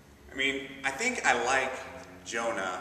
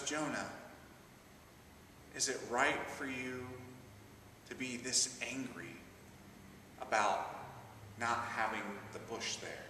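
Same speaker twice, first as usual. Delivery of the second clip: softer, patient questioning; gentle God; 9 dB quieter than usual. Acted. God asks angry Jonah, soft patient question. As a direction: ask it like a calm parent.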